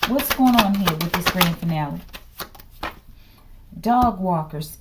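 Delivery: normal talking voice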